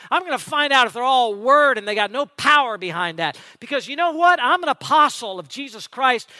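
high-pitched, righteous challenge; indignant challenge. Acted. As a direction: take on the boasters as a defiant apostle. Acting out Paul's fiery challenge.

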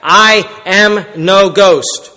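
very slow, spaced-out "I am no ghost"; emphatic Jesus. Acted. Jesus denying he is a ghost, emphatic "I am no ghost". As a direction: punch each word of "I am no ghost" separately. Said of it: "He was emphatic".

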